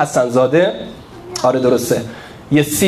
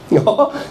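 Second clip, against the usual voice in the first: short laugh; 6 semitones higher than usual. Real, not acted. Laughing at own point.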